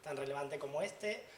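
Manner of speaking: normal talking voice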